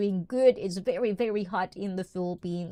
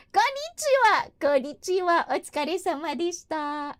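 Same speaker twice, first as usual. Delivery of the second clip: excited squeal; 10 semitones higher, 4 dB louder than usual. Real, not acted. Squealed greeting to chat viewers.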